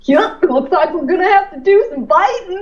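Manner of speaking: goofy cartoon dog voice with a laugh. Shown as a demonstration. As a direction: do Goofy, starting with a yuck. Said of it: "can you give us a bit of Goofy".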